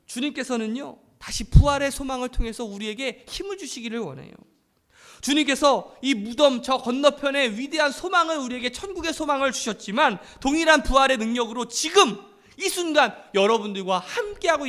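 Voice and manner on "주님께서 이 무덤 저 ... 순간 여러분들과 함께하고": raised, emphatic sermon voice; forceful preacher's exhortation; impassioned preaching. Real, not acted. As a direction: press hope on the congregation, phrase by phrase.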